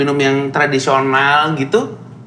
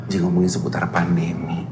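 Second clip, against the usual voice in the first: hushed, secretive whisper; 11 semitones lower, 7 dB quieter than usual. Acted. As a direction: lean in and whisper it. Host drops voice for serious question.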